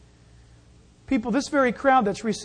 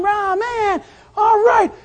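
high, loud mock crowd cheer; yelling crowd; 10 semitones higher, 6 dB louder, slower than usual. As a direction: holler it like an excited crowd member. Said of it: "Everybody's yelling".

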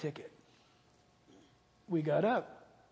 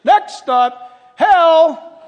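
loud, high conductor call, laughing; 9 semitones higher, 20 dB louder, much slower than usual. Retold or imitated. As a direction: announce hell as the next stop. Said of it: "It's like".

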